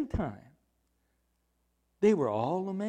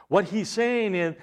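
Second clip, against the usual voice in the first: ordinary talk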